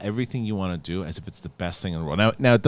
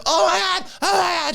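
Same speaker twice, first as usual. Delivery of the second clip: put-on very high, loud child shriek; excited shout; 5 dB louder than usual. Kid shrieking about breakfast for dinner. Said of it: "And then".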